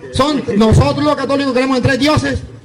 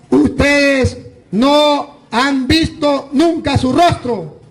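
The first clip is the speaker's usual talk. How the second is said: very slow emphasis; much slower than usual. Acted. Preacher drives home scripture.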